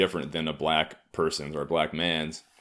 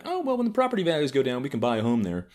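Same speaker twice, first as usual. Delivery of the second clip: mock rich liberal; 7 semitones higher, faster than usual. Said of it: "being like".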